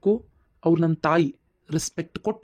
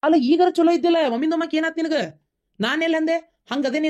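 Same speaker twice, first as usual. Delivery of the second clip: angry protest; 9 semitones higher than usual. Acted. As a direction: snap back in the quarrel. Husband angrily protesting in quarrel. (ಸಿಟ್ಟಿನಿಂದ ಜೋರಾದ ಪ್ರತಿಭಟನೆ)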